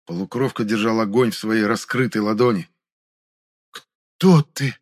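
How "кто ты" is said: startled, raised-pitch gasp; frightened gasp, higher and louder; convulsive gasped question. A narrator's reading. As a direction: blurt it out on a sudden loud breath. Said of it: "судорожно выдохнул Хаосил".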